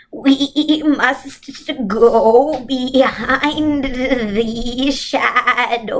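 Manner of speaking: slow, drawn-out otherworldly voice; eerie slow witch voice; Missus Witch's voice. Acted. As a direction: stretch every word like an ancient being.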